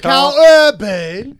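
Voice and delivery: high, loud, stretched put-on accent; imitating Karl Urban's accent. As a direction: bellow the name slowly in mock accent. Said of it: "why we were doing that".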